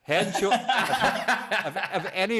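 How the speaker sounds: slow, loud, high laugh breaking the words. Real, not acted. Pitching to shampoo reps, breaking into loud laughter. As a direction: crack up mid word.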